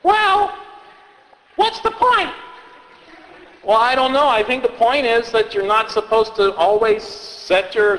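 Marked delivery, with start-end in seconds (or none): 0.0-2.3 put-on high kid voice, suspicious; a kid catching a contradiction; puzzled child. Child questioning the storyteller in a high kid voice.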